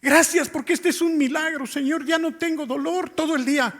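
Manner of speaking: high, pleading prayer of gratitude; imitating tearful thanks. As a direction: act out weeping thanks to God, without real sobs. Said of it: "empezó a llorar y decirle".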